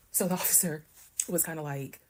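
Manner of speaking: ordinary delivery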